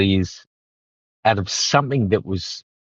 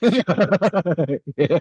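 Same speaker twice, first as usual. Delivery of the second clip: laughing; 8 semitones higher than usual. Real, not acted. Laughing reaction to affair question.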